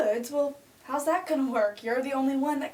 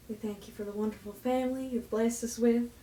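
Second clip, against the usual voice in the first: normal talking voice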